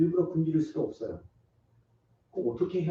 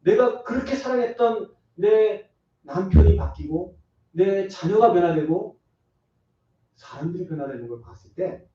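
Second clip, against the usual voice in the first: ordinary talk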